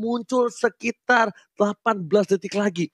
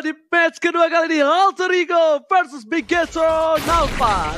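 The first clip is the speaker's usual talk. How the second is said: announcer shout; 5 semitones higher, slower than usual. Real, not acted. Caster announces the match-up.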